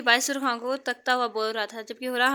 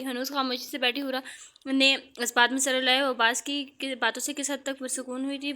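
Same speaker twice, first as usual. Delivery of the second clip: plain reading voice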